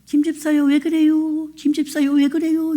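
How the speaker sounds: a husband suddenly meek as a lamb, asking the deacon what's wrong. Retold or imitated. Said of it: "순한 양처럼".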